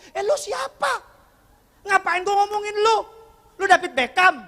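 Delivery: hammed-up offended shout; mock angry retort. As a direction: act out a touchy man flaring up. Retold angry offended man.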